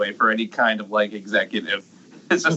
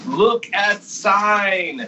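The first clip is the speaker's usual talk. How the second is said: very slow, drawn-out quote; slow quoted catchphrase; quoting a TV line; 7 semitones higher, much slower than usual. Retold or imitated. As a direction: stretch each word like the character. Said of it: "the line from the show The Critic".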